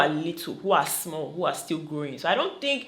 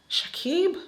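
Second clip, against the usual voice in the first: swooning call; 11 semitones higher than usual. Real, not acted. Savouring his name, crushing on him.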